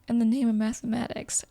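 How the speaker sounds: ordinary delivery, nothing marked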